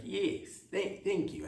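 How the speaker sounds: high, soft polite thanks from a foreign dignitary; mock foreign lady. Foreign dignitary thanking the president, high and soft. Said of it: "she's like".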